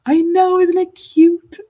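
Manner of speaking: high, loud, slow coo; swooning at how cute it is; gushing over cuteness. Real, not acted. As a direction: coo over something adorable. Gushing over the bunny ears.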